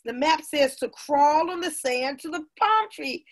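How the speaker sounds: ordinary delivery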